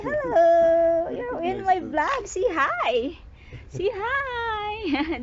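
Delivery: high sing-song voice for the dog; high pet talk. Real, not acted. Talking sweetly to the dog.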